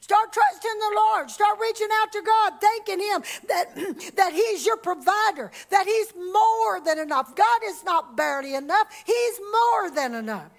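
high, loud preaching build; rising shouted praise to the congregation; fervent preaching. Acted. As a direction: preach it out with full fire. Preaching at full force.

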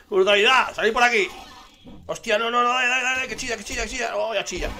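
high, loud excited yelling, a laugh at the start; gritos de euforia. Real, not acted. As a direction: shout frantically to teammates mid-fight. Gritos en plena acción del juego. (gritando eufórico, agudo y fuerte)

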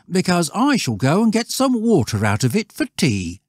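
put-on high, loud boastful boy; cocky lad declaring his plan; schoolboy Stanley. Voices Stanley.

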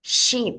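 "Sheep" with curled tongue and pouted lips. Shown as a demonstration. Teacher repeats "Sheep" for children, tongue curled and lips pouted. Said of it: "Các con nhớ uốn lưỡi nhu miệng lên".

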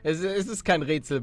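ordinary talk

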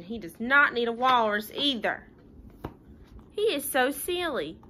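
usual speaking voice

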